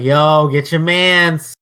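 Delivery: loud, drawn-out "Yo"; stretched-out mock holler; mock calling out. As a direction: imitate a fan hollering a complaint. Acts out people calling him out.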